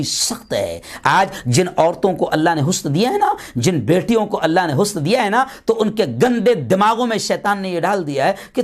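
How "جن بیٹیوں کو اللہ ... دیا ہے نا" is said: normal talking voice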